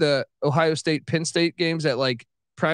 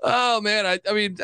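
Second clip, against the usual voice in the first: ordinary delivery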